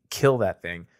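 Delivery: normal talking voice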